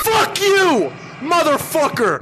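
yelled with pitch way up; furious retake. Acted. Redoing the speech as a furious shout. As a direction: scream the insult at the creation. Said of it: "we're redoing it".

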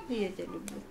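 soft loving murmur; tender whisper. Real, not acted. Mother tells toddler she loves him.

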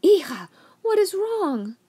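high, slow, anxious grandmother; worried. A narrator's reading. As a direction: ask in sudden alarm.

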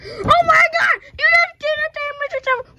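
loud, high shocked cry; dismayed shout. Pretend. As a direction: shout in horror at the beatdown. Toy wrestler beats favorite character.

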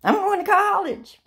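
high, loud triumphant cry; triumphant announcement. Retold or imitated. Telling family she's going to college, triumphant and loud. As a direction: announce it like a victory. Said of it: "when I got home".

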